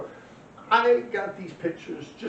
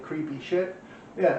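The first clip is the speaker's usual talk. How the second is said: usual speaking voice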